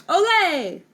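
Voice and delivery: high, loud cheer; bright cheer of olé. A narrator's reading. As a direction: shout it like a happy bullfighter. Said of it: "he cheered".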